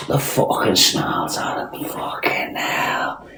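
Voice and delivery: breathy hushed swoon over his own face; hushed mock-admiring whisper; mock romantic whisper. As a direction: whisper it like a lovestruck fan.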